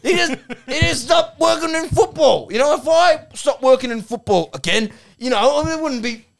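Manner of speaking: very high, loud, worked-up protest; Duck. Acted. Duck insists Eddie only stopped working in football, shrieky indignation. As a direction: strain upward in indignant ranting. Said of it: "aggressive Australian male".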